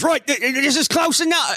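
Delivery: high nervous captain. Acted. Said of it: "the captain goes".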